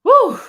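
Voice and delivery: sharp, high, loud exhale after the stairs; winded whew. Real, not acted. To his viewers after running the stairs, a loud winded whew. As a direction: blow out a hard breath, winded. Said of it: "I'm out of shape 'cause that running down those stairs".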